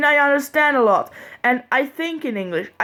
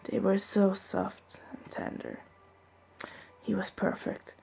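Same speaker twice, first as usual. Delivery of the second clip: hushed, swooning read; breathless whisper of a love scene; romantic whisper; 2 semitones lower, 12 dB quieter than usual. Acted. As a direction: whisper it, dreamy and soft. Reading the romantic kiss passage.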